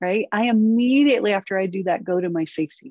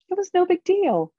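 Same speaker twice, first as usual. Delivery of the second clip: high, offhand daughter's reply; lightly mimicked daughter, pitched up; imitated daughter; 10 semitones higher than usual. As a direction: toss it off lightly in a higher voice. Voices her daughter's possible reply.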